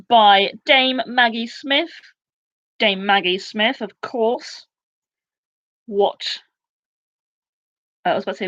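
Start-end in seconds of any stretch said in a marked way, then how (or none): none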